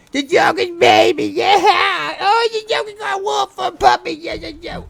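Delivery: high excited puppy-talk shout; excited squeal. Real, not acted. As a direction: shriek it out in glee.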